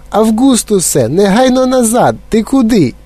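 very high, frantic command; mother's shriek. A narrator's reading. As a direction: shriek after your son to come back. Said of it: "заверещала пані Глуп". (несамовитий материнський вереск)

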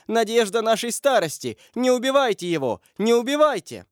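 high, anguished begging; mother's pleading cry. A narrator's reading. Mother begs the attacker for her son, crying out. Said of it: "кричала мать".

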